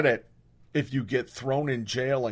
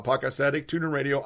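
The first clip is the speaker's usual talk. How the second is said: ordinary talk, nothing marked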